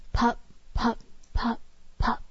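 soft fading pop pop like a sputtering engine; dying engine pops; engine putter. Retold or imitated. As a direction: mimic a car running out of gas. Imitating a car engine sputtering.

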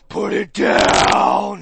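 slow, loud booming order; deep booming command, drawn out; resounding command. Retold or imitated. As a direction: thunder it down from above. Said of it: "you just hear this resounding".

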